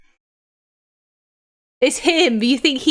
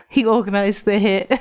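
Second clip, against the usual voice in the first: ordinary talk, nothing marked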